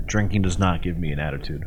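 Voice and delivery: low mutter. Real, not acted. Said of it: "repeated line".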